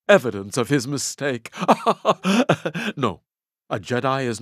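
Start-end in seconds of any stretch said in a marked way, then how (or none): 1.6-2.9 high, gloating chuckle of triumph; gleeful laugh. Acted. Jedi gloating over the tyrannical research manager, gleeful laugh. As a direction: laugh like you've just won.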